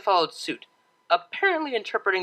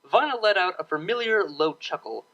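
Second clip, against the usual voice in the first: usual speaking voice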